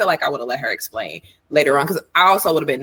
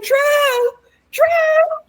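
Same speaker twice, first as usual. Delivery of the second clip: high, desperate calling out; yelling a name; 5 dB louder than usual. Retold or imitated. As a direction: call out across the room, high. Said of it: "started yelling out Drew's name".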